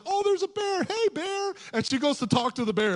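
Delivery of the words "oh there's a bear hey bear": very high, put-on girlish voice; excited little girl. Girl spots a bear and greets it, high girlish voice. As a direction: call out in a squeaky, naive girl's voice. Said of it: "She's like".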